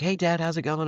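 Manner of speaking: high, breezy innocent hello; casual teen greeting. Retold or imitated. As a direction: play it cool like nothing happened. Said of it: "he was like".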